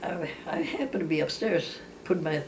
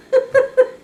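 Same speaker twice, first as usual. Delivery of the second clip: loud squealing laugh; high burst of laughter; 11 dB louder than usual. Real, not acted. Laughing at her sister's words, a loud high laugh. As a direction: break into a shrieking laugh.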